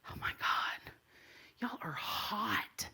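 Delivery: slow, breathy hushed aside; whisper. Retold or imitated. Woman admiring two girls at the curb, whispered. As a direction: whisper it so the girls can't hear. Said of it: "And I was like".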